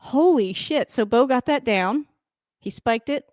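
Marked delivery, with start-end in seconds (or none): none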